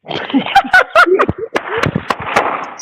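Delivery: breathless, high-pitched laugh; a disbelieving wheeze of a laugh; high airy laugh. Real, not acted. Host laughing off the caller's sister claim, airy laugh.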